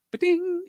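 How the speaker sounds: very high sing-song ding; ding sound effect. Pretend. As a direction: chime it like a rimshot.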